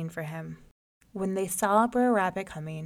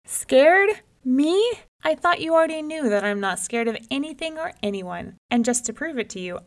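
scoffing; 3 semitones higher than usual. Acted. Rabbit scoffing at folks who call him scared. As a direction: scoff at the very idea. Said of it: "Brer Rabbit scoffed".

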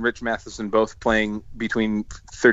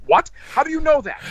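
mock astonishment. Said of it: "And Kevin goes".

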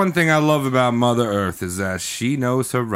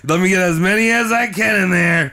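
mock Mother Teresa; 8 semitones higher than usual. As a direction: gloat like a greedy saint. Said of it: "She's like".

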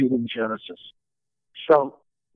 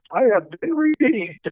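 much higher, gleeful retold crowing; triumphant producer; 10 semitones higher than usual. Producer telling Phil he was vindicated, high and gleeful. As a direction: crow it like you were proven right.

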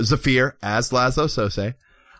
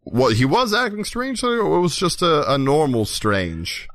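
Laszlo, puzzled investigator; 4 semitones higher than usual. Acted. Laszlo querying Nick's answer, high and puzzled. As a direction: press the question with theatrical puzzlement.

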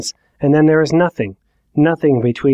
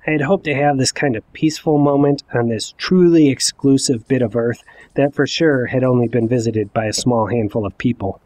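normal talking voice

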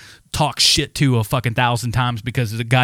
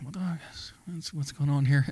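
mock whisper; 9 dB quieter than usual. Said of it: "They're like".

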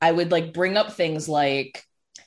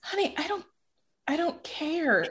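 put-on drunk mom; 10 semitones higher, 7 dB quieter than usual. Mom reassuring upset child after drinking, drunk voice. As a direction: imitate a tipsy mother waving it off quietly. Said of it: "she's been drinking".

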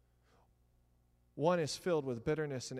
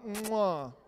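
blown kiss sound; 5 semitones higher, 7 dB louder than usual. Acted. Kiss after scolding newlyweds.

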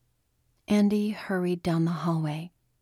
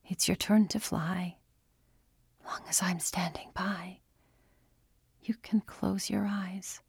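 whispered lullaby; 5 dB quieter than usual. A narrator's reading. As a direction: breathe the words like a lullaby. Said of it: "she muttered".

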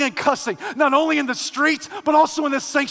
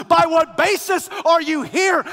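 louder, higher, ringing out to the congregation; preacher repeating the angel's question, loud; preached loud; 5 semitones higher, 4 dB louder than usual. Acted. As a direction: throw the question out to the whole church. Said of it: "Did you hear what I just said?".

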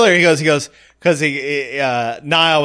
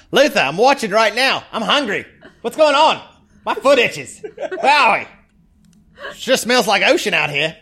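high, giggly whine to Luther; a silly lookout griping into the radio; whiny goofball voice; 8 semitones higher than usual. Pretend. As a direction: play the bored lookout, half laughing. Voicing a bored character on radio.

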